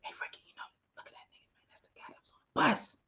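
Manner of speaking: hushed secret whisper between twins. Acted. Twin whispers to brother about the man, hushed. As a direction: whisper so the guy can't hear.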